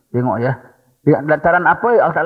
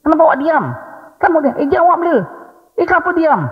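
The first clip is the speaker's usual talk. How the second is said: nagging wife; 12 semitones higher than usual. Retold or imitated. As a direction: badger him to answer, pitched high. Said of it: "Dia ngomel-ngomelkan kita".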